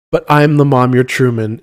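usual speaking voice

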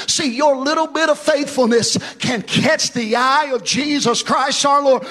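loud, high, half-sung preaching; preaching at full force. Acted. Pastor urging his congregation to stay faithful, loud ringing preaching. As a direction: ring it out over the congregation like a revival.

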